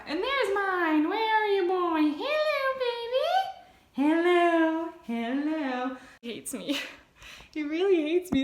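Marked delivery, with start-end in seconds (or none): 0.0-6.0 high sing-song pet voice. Real, not acted. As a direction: coo lovingly at the cat. Cooing to her cat.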